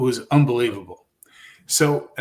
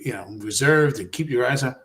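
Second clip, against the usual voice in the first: usual speaking voice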